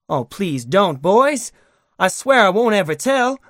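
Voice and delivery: high, loud, frightened begging; wailing plea. A narrator's reading. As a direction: beg for your life, voice cracking upward. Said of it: "heard a voice wail out".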